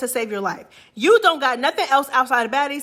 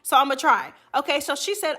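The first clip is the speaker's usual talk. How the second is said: ordinary talk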